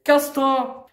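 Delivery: high, angry outburst; angry dismissal. Real, not acted. Young woman telling the educator to get out, angry. Real quarrel, sending him away.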